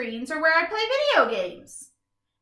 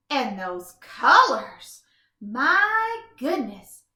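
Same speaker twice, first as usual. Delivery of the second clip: awed wonder; 7 dB louder, much slower than usual. Acted. Awed by sunset.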